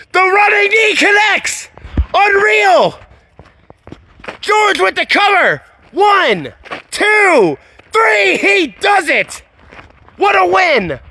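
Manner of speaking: ecstatic commentator. Real, not acted. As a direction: scream the count and the win. Commentator calls the winning pin.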